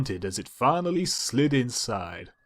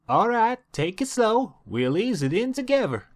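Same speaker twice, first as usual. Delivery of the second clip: much higher, coaxing guidance; high country sister voice; 10 semitones higher than usual. Acted. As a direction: guide him, high and encouraging. Applejack guides him.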